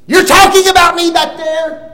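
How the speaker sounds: high, loud accusing shout; loud call-out. Retold or imitated. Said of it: "and I say".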